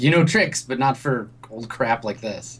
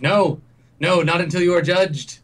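higher and louder, refusing firmly; stern high communicator; 7 semitones higher, 3 dB louder than usual. Acted. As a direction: shut them down like a zealous priest.

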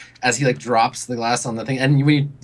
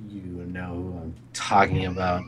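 put-on low, soft drunken mumble; drunk brooding; 7 semitones lower, 6 dB quieter than usual.

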